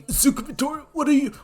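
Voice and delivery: high, startled shout of disbelief; confused gasp. Acted. Guards shouting at the flying intruder, shocked and high.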